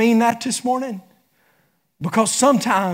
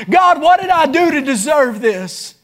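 raised, louder anguished plea to heaven; preacher voicing a hurting believer's raised cry to God; 5 semitones higher, 4 dB louder, slower than usual. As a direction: cry out why me like a desperate prayer. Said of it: "We cried out".

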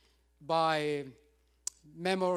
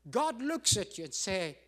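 usual speaking voice

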